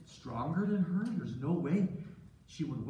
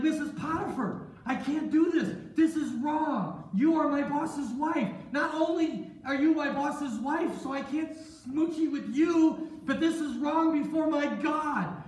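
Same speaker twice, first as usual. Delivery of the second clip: high, loud, emphatic refusal; alarmed protest; 10 semitones higher, 5 dB louder than usual. Acted. Joseph refuses his boss's wife, alarmed protest. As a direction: plead at a high pitch, drawing out the words.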